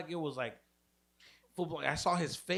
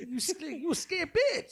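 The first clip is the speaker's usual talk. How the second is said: laughing taunt; 13 semitones higher, 4 dB louder than usual. Pretend.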